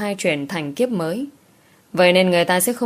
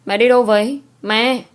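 calling out higher and louder after her; calling after mother; 4 semitones higher, 4 dB louder than usual. A narrator's reading. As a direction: call out to the mother as she walks away. Daughter calls after leaving mother. (gọi với theo mẹ, to và cao giọng)

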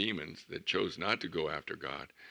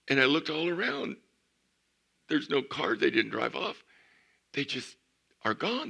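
amazed; 8 semitones higher, 6 dB louder than usual. Real, not acted. Pastor reliving astonishment.

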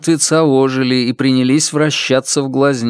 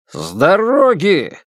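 shout; 6 semitones higher, slower than usual. A narrator's reading. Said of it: "крикнул мне другой голос".

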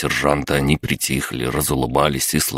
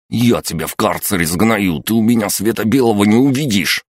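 indignant threat climbing higher; yelled threat; 6 semitones higher, 3 dB louder than usual. A narrator's reading. Sidorovets threatens the prisoner who insulted him, outraged yelling. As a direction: threaten him angrily, rising into a yell. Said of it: "завопил тот".

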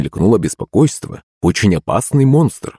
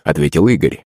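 usual speaking voice

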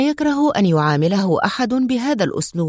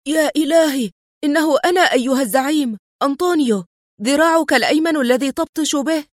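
panicked cry; 6 semitones higher than usual. Acted. Antonio panics before his boss, high alarmed cry. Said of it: "وصاح بجزع".

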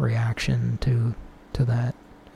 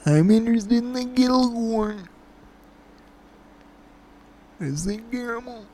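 high prank caller voice; 9 semitones higher than usual. Pretend. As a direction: ask earnestly like a weird caller.

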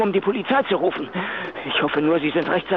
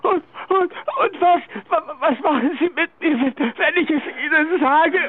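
higher, stuttering terrified question; stammering fear; 7 semitones higher than usual. Acted. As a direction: stumble over the words in panic.

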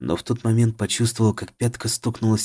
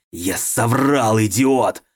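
louder, slow snarl; growl; 3 semitones higher, 5 dB louder, much slower than usual. A narrator's reading. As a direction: growl the insult. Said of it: "рыкнул он в ответ".

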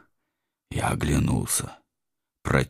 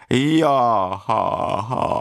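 Tigger whoop; 9 semitones higher, much slower than usual. Acted.

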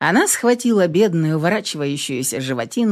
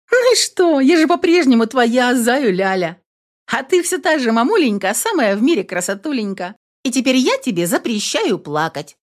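higher, bright playful cheer; playful daughter; 6 semitones higher than usual. A narrator's reading. Daughter cheering up her tearful mom, playful.

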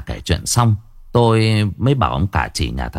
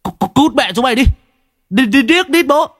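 much higher, louder stuttering curse; stammering curse; 13 semitones higher, 3 dB louder than usual. Acted. Quách curses the men at his door, stuttering rage. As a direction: spit the curse out, tripping on the first sounds. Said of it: "Thằng Quách chửi".

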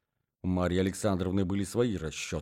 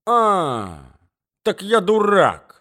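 loud, high outburst of rage; furious shout; 9 semitones higher, 8 dB louder, much slower than usual. Acted. Jilted suitor to the woman who called him a fool, furious. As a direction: shout it, boiling over, drawing the words out. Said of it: "закипел от ярости".

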